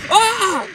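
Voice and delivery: sudden high yelp of alarm; startled yelp. Real, not acted. Gamer startled by a sudden drop, high yelp. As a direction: yelp as you fall. Falling in Roblox game.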